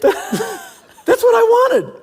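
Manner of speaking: hammed-up wife impression; mimicking wife. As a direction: stretch it out high and mocking while laughing. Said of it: "echoes wife's line".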